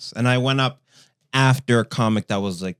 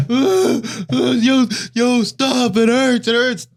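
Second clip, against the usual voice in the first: pained cry; 13 semitones higher, 7 dB louder, slower than usual. Retold or imitated. Acting a person in pain.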